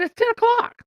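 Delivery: high, disbelieving exclaim; can't believe dad's quitting this early; a retold jumping-up it's only ten; incredulous. Telling dad it's only ten.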